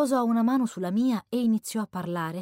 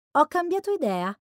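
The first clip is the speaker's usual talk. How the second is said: mildly irritated, higher-pitched retort; 8 semitones higher, 4 dB louder than usual. A narrator's reading. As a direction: answer back with a touch of irritation. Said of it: "rispose lui irritato". (risposta un po' irritata)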